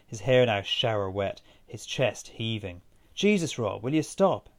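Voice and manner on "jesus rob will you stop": higher-pitched, mild narrated shout. A narrator's reading. Aisling to Rob running wild at pool, mildly shouted. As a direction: call out for him to stop, half raised voice. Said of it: "Aisling shouted".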